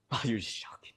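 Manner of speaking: soft breathy whisper; whispered tease to chat. Real, not acted. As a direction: hush it like a secret aside. Aside reacting to chat.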